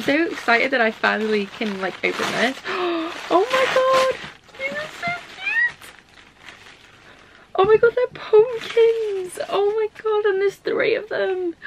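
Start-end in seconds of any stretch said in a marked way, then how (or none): 2.6-3.2 excited gasp. Real, not acted. Opening handmade gift. 3.3-11.6 very high, near-crying squeals of delight; overjoyed squeal. Real, not acted. Vlogger unwrapping handmade pumpkins, squealing with joy.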